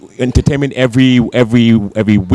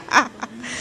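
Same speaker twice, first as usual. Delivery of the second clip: high, soft laugh; soft chuckle; 12 semitones higher, 7 dB quieter than usual. Real, not acted. Host pleased about the legendary fried chicken, soft chuckle.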